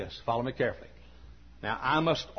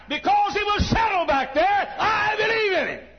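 preaching shout; 8 dB louder than usual. Real, not acted. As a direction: ring it out from the pulpit. Preaching climax.

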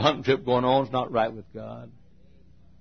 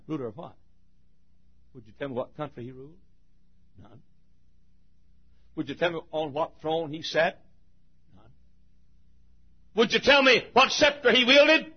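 usual speaking voice

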